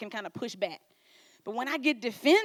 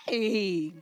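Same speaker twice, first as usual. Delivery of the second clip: bright mock hello; 2 semitones lower than usual. Greeting someone you like.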